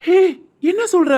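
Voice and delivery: high, sharp shocked question. Acted. Groom jolted by the bride's sudden news, shocked. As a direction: gasp it out in disbelief.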